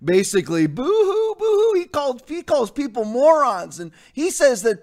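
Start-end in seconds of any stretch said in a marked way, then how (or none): 0.7-1.8 high mocking boo-hoo, sobbing whine; mock boo-hoo crying. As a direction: sneer it like a fake crybaby wail. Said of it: "the guy puts on there".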